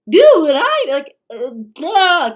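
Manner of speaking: loud, high hammed-up babble; mock ranting blather. Host parodies influencer's orders, loud mock blather. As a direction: burst into silly mock shouting.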